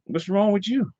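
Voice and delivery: high-pitched imitation of a challenging relative; high, querulous mock question; relative's challenge. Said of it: "he's saying to me".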